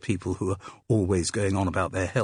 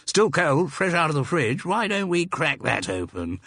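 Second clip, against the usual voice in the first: higher, hearty English public school voice; hearty public school accent; 8 semitones higher than usual. A narrator's reading. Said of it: "in a hearty public school accent".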